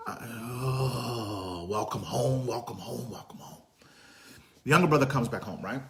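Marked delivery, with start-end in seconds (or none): 0.1-1.7 choked sob. Real, not acted. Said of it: "I'm not crying". 1.7-3.6 tender welcome fading to whisper. Acted. Father greeting his returning son, tender and fading.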